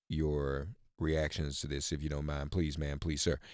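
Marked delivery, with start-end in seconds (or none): none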